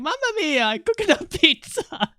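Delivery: high, hammed-up Italian accent; mock Italian accent. Tweet guy trolling angry replies, hammed-up Italian accent. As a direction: play the cartoon Italian troll. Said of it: "the guy in the tweet kept replying".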